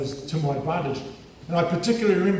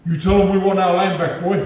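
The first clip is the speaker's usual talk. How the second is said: raised, louder retold demand; mock demand; 4 semitones higher, 8 dB louder than usual. A man retelling someone's land demand, loud mock demand. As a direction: push it out louder and higher as an imitation.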